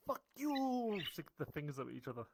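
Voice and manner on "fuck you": shouted insult across the street, high; mock yell. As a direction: yell it at someone far away. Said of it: "walking around going like".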